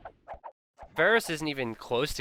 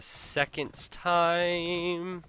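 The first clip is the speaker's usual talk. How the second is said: SECOND'S TIME raised high, TIME held long; high sing-song stretch; 7 semitones higher, much slower than usual. Pretend. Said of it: "I'm not going up any more octaves".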